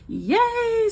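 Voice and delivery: high squeal of "Yay"; happy cheer. Real, not acted. Telling viewers about the debt payment, a high happy cheer.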